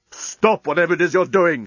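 shouted. A narrator's reading. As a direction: order him to stop, raising the voice. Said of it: "shouted Leovinus".

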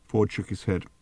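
ordinary delivery, nothing marked

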